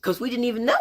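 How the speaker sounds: put-on high, pleading kid protest; protesting children. Sisters trying to tell mom, high protesting voice.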